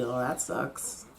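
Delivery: ordinary talk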